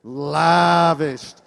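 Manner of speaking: Lavished stretched very slow and loud; long drawl. Shown as a demonstration. Pastor leading church to drawl Lavished, long and slow. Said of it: "a long drawl".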